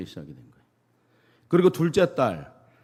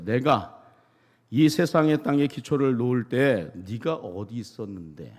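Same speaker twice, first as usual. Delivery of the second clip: ordinary delivery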